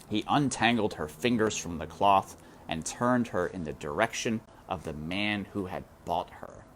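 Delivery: usual speaking voice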